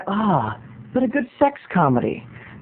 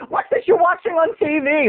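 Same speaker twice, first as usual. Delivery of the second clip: higher, shocked mom voice; mom's outraged question; 8 semitones higher than usual. Retold or imitated. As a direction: play the scandalized mother walking in. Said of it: "she's like".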